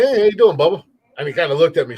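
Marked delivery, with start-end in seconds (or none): 0.0-0.8 higher, louder hearty greeting; retold hailing. Country guy greeting a man at the beach, hearty call. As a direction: hail a passing stranger loudly.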